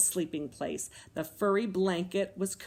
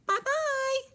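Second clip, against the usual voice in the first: tiny bear calling out; high squeaky voice; 4 dB louder than usual. A narrator's reading. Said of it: "a high squeaky voice calling to her".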